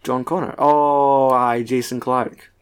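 normal talking voice

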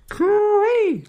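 very high call through cupped hands; cooee through hands. Shown as a demonstration. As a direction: send a high cooee across country. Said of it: "with their hands like this".

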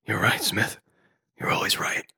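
very soft, breathy concession; hushed surrender. Acted. Neo concedes to tormented Smith, hushed. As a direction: give in under your breath.

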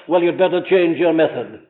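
normal talking voice

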